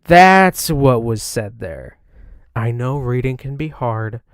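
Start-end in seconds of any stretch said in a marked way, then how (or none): none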